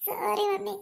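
put-on teary little boy begging mom's pardon; crying sorry. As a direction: whimper it out like a crying kid. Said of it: "എൻ്റെ മോൻ ഇങ്ങനെ കിടന്ന് കരയല്ലേ?".

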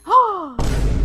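high, loud theatrical gasp; dramatic gasp. Acted. Player caught consulting the lawyer, dramatic gasp. Shock at boss knowing about lawyer.

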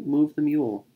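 ordinary delivery, nothing marked

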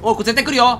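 loud, high alarmed call; scared warning. Real, not acted. Gamer warning the character that something is coming, alarmed. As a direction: brace for the scare, voice up.

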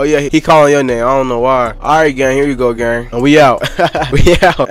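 ordinary talk

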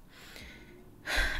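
long breathy sigh. Real, not acted. Storyteller confiding a story kept from family, sighing. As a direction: exhale slowly before going on. Pauses after swearing story is true.

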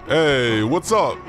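ordinary talk

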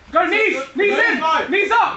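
high, punchy corner shout; urgent coaching shout. Real, not acted. Coach calling for knees, urgent shout. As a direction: shout short commands at the fighter.

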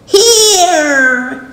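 high, loud witch voice; cackling hag; old hag. A narrator's reading. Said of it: "said the witch".

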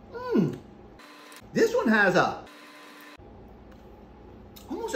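speaking between bites; talking while chewing. Real, not acted. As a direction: mouth partly full. Seen 0: puts tomato pieces to mouth repeatedly, talks between bites.

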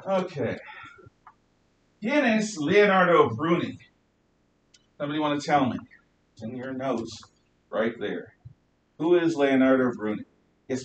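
ordinary talk, nothing marked

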